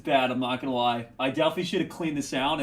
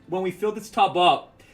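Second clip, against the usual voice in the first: normal talking voice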